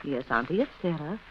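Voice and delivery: very soft, slow and syrupy; sugary tenderness. Acted. Sarah coos falsely to her dying aunt, soft and syrupy. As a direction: coo it falsely at the sickbed.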